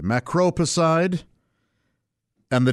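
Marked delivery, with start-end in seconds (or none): 0.0-1.2 macropicide repeated slowly; lesson-style slow repetition. Shown as a demonstration. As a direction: say Macropicide once more, unhurried.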